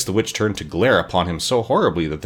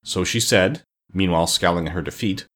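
plain reading voice

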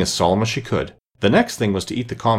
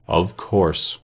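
low, weighty reply; very grave; 4 semitones lower than usual. A narrator's reading. Dodo answers the mouse, very gravely. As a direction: pronounce it with great gravity. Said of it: "the dodo replied very gravely".

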